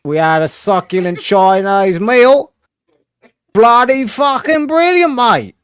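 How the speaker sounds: put-on Australian accent. Aussie bit continues.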